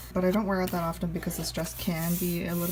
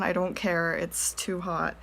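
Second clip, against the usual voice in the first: ordinary talk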